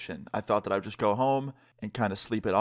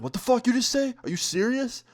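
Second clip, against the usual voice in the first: high-pitched mock outrage; pissed off; 13 semitones higher than usual. Head Chad confronting the guy he jumped, pissed off. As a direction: imitate an incensed bully, pitched way up. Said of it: "he got so pissed".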